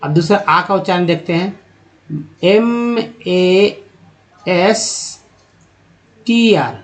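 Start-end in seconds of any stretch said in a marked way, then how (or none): 2.4-6.8 very slow letter by letter; spelling out letters. Shown as a demonstration. Teacher spells master slowly for students. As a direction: spell M A S T R drawn out. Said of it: "क्या उच्चारण करते हैं".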